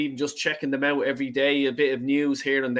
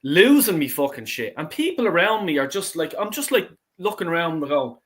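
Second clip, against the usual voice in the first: ordinary delivery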